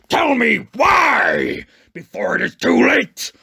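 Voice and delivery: slow, booming monster roar; fierce shout. A narrator's reading. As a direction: bellow it, demanding an answer. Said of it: "the monster said fiercely".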